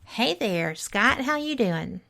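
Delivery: ordinary talk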